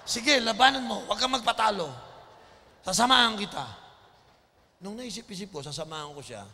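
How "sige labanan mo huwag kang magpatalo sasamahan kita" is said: loud, higher fatherly command; father pushing his son to fight again, loud; stern loud order. Retold or imitated. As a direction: order the boy back into the fight.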